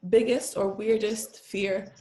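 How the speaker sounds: slow, careful Canadian accent with the r in "weirdest fear" sounded. Shown as a demonstration. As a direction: repeat it with a bit of a Canadian accent. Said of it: "I gotta put a bit of my Canadian accent".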